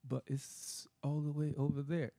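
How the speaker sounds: a slow, lightly whiny protest; slow mock complaint; imitating Fendler. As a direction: drag it out as if the stage were miles long. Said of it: "the way he said it was really funny".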